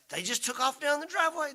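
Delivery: high, urgent rush of words; panicked. Retold or imitated. Panicked dad telling River the kids ran off, high and urgent. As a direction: alarm in every word.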